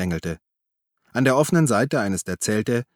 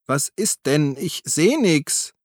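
high, drawn-out call from below; 6 semitones higher, slower than usual. A narrator's reading. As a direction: call up helplessly from a ditch. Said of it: "rief die Stimme aus dem Graben". (von unten gerufen)

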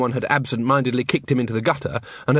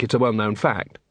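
usual speaking voice

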